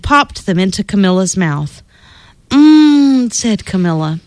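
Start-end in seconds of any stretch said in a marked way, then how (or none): 2.5-3.3 louder, relished hum of taste; delighted mmm. Acted. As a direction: savour it. Camilla tastes the beans.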